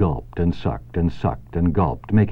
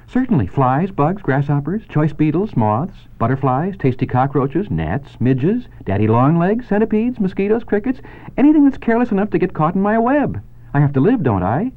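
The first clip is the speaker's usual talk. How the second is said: much higher, matter-of-fact spider voice; a dainty spider listing her tasty prey, pitched up high; spider Charlotte; 9 semitones higher than usual. Acted. Charlotte the spider telling Wilbur what she eats, high and cheerful.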